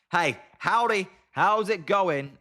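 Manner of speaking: lightly hammed-up cowboy accent, drawn out at the end. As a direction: greet them in a badly faked cowboy accent. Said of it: "that was an embarrassing, um, accent there".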